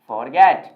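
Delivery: forget as slow FOR-get, full o; common mispronunciation. Shown as a demonstration. Teacher building the word from its spelling, common mispronunciation. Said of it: "इसे बोलते हैं for".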